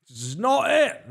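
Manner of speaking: exasperated host declaring the rotation done; emphatic outburst. Acted. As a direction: belt "This is not it." out in frustration. Repeated verdict, third time louder.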